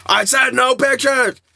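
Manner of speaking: loud, high, slow furious yell; angry shout. Retold or imitated. Lou yelling at the pushy fan, slow furious shout. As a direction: bellow it, spacing out every word. Lou gets aggressive, refuses photo.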